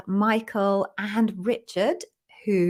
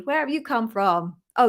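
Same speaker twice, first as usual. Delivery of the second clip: usual speaking voice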